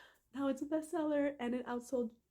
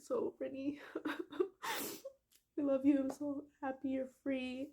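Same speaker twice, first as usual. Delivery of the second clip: higher voice breaking on a sob; tearful; 4 semitones higher than usual. Real, not acted. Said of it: "I get all emotional".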